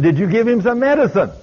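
high-pitched demanding question; retold urgent questioning. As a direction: act out an astonished mother pressing for an answer. Mother questions babysitter, retold.

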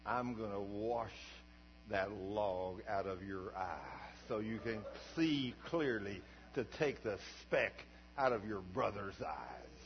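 a hint of God speaking gently and slowly; God's answer. God answers the pastor about the log in his eye, slow and low. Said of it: "Here's what he said".

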